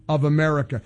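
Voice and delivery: ordinary talk, nothing marked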